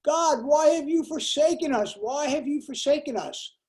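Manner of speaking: old woman crying out to God, mock despair. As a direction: plead with the sky, hammed up. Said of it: "looks up to heaven and says".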